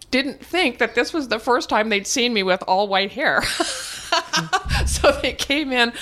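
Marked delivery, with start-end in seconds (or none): 3.5-4.8 a bright high laugh; high burst of laughter. Real, not acted. 4.9-5.9 words bubbling with laughter; laughing while talking. Real, not acted. Laughing through the hospital story.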